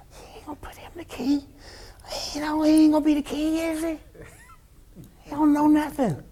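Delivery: high, hushed whisper; whispering brothers. Retold or imitated. Brothers doubting David in a high whisper. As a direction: gossip it behind his back. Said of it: "See, they ain't saying".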